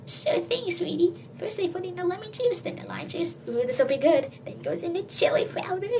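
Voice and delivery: lightly voiced, often hushed high grandma; a dotty old grandma cooking. Grandma narrating her odd stew recipe, hushed high voice.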